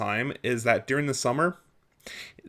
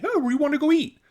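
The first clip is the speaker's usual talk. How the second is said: much higher, louder, chipper dad voice; cheery dad; 4 dB louder, faster than usual. Retold or imitated. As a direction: mimic a carefree, happy dad. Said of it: "my dad, you know, happy as can be".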